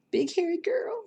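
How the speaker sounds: high squeaky teasing voice; high silly voice teasing a viewer; squeaky mock voice. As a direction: squeak it out like a cartoon. Repeats viewer's phrase in funny voice.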